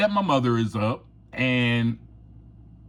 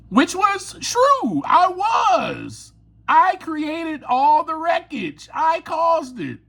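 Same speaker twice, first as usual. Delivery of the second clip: earnest confession; 13 semitones higher, slower than usual. Real, not acted. Admitting guilt with emphasis.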